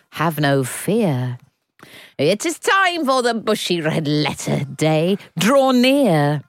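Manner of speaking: slow, dramatic proclamation; announcer voice. Acted. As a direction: proclaim it grandly. Jingle-style announcement.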